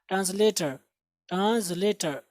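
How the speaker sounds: "ट्रांसलेटर" with a light z before the l; soft z-sound demo. Shown as a demonstration. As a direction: model "ट्रांसलेटर" as tranz-later. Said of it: "थोड़ी सी जा की आवाज़ आएगी".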